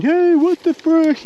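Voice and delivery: high, slow, drawn-out objection; stretched-out, high-pitched outrage; indignant protest. Retold or imitated. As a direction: imitate a buddy protesting after a smack. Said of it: "hear Jeff saying".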